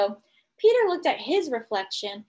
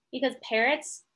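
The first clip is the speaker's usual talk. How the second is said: plain reading voice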